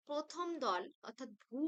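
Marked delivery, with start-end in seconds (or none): 1.4-1.6 ভূ held on a long vowel; drawn-out syllable. Shown as a demonstration. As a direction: stretch out the vowel of ভূ. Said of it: "এটিকে আমরা টেনে উচ্চারণ করতে পারছি". (ভূ টেনে উচ্চারণ)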